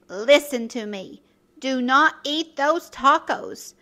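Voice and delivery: usual speaking voice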